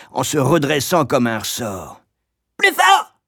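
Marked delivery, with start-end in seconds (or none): 2.6-3.2 very high, loud screech; shrill yelping. A narrator's reading. Woman in the corner urging harder blows, yelping. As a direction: screech it like a yapping harpy. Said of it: "glapit une voix".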